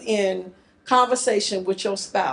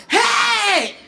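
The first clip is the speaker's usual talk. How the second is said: high intercom-style holler; loud holler; 12 semitones higher, 4 dB louder than usual. Retold or imitated. Family calls across the house, loud holler. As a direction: holler through a two-story house. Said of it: "We was the loud family".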